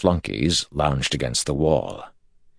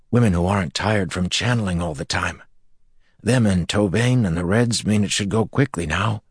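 put-on eager young man; 6 semitones higher than usual. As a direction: spill the news eagerly. Evan, turned, eagerly explains.

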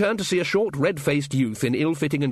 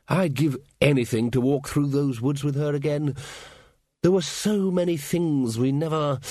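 lingering, slightly raised reminiscence; wistful old wizard; 3 semitones higher than usual. Acted. Ridcully longing to walk the woods with her, wistful. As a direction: drift into regret, leaving it unfinished.

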